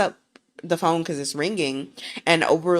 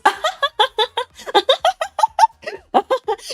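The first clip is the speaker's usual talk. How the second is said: high mocking laugh in his face; mocking cackle; 7 dB louder than usual. Wife laughs scornfully at the choking boss. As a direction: laugh right at him, scornful. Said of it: "she's laughing in his face".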